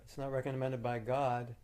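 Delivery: ordinary talk, nothing marked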